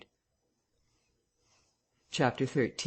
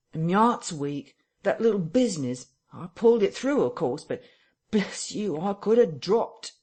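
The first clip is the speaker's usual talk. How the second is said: higher, louder aggrieved tramp; Marvel complaining; 6 semitones higher, 3 dB louder than usual. A narrator's reading. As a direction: plead your weakness as a frightened tramp. Marvel voiced in story.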